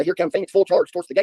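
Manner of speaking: ordinary talk, nothing marked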